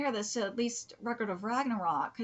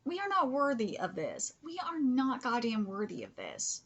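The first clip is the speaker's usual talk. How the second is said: usual speaking voice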